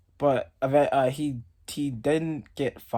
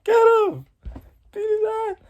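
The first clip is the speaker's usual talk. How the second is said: much higher, rising excited egging on; hyped cheering; 3 dB louder than usual. Retold or imitated. Freshman hyping teammates to beat the clown, high pitched. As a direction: cheer the pile-on, squeaky and pumped. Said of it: "I just was like".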